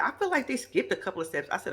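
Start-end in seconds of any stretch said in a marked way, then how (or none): none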